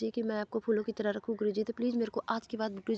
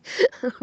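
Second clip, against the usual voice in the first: short high burst of real laughter; laughing at herself, loud; sudden laugh; 10 semitones higher, 8 dB louder than usual. Real, not acted. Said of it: "मुझे हँसी भी आ रहा है". (अचानक ज़ोर की हँसी)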